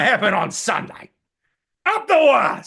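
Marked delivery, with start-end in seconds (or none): 1.9-2.7 loud, high rallying cry; fan cheer. Real, not acted. A fan rallying for the Warriors, loud. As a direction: cheer your team on.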